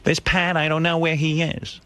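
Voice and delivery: a hint of a fussing old mom; mother grumbling about the trainer, mildly mimicked; imitating his mother. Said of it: "My mother goes".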